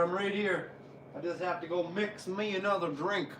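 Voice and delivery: normal talking voice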